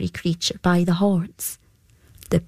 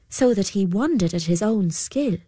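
ordinary delivery, nothing marked

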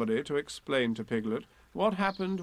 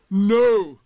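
very loud, raised no; stubborn outburst; flat refusal; 7 semitones higher, 14 dB louder than usual. A narrator's reading. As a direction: refuse emphatically. Eeyore refuses Rabbit.

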